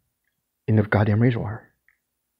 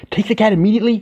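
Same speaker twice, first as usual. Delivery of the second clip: urgent order; 11 semitones higher, 4 dB louder than usual. Retold or imitated.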